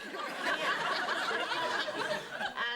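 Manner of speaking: long, high, airless laugh; helpless whispered laughter; breathy laughter. Real, not acted. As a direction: laugh at the memory. Laughing at the story.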